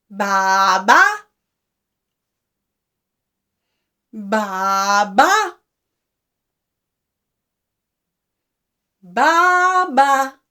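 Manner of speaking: long baa followed by a second baa; sheep bleat. Shown as a demonstration. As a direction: double baa, drawn out. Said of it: "Sheep says".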